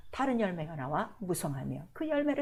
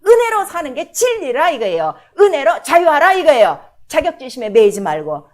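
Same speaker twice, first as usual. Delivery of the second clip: loud, fervent preaching; preaching at full force; 2 semitones higher, 11 dB louder than usual. Acted. Pastor preaching hard to the congregation, loud and fervent. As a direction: drive it home to the congregation.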